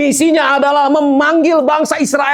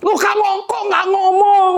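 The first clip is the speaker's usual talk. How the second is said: high, slow, half-shocked reproach; reproachful driver; 10 semitones higher, slower than usual. Retold or imitated. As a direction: scold him gently in a high voice. Driver scolding mildly. (menegur dengan heran, nada tinggi)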